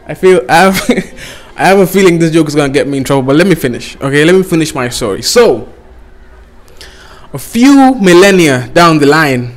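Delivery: ordinary talk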